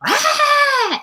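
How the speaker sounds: very high, loud mock shriek; sustained piercing fake wail; imitated child's meltdown. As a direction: scream like a toddler whose world ended. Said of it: "is the end of the world".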